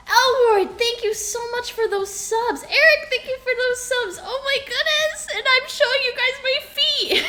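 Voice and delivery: high, loud, thrilled thank-yous; excited thanks. Real, not acted. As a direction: shout your thanks, overjoyed. Thanking subs in excited yells.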